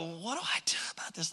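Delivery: hushed, unsure question; a soft, worried aside; worried whisper. Acted. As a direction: whisper it like a private doubt. Quoting a worried listener.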